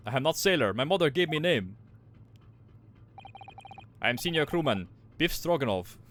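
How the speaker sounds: proud sailor in a put-on Russian accent. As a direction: announce yourself in a Russian accent. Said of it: "I assume they're all Russian".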